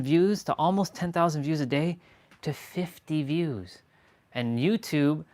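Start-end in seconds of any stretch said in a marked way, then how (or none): 2.4-3.8 drawn out and sinking, much slower; slow deflated drop. Real, not acted. Telling viewers her views crashed, slow and deflated. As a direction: let the number land slowly in dismay.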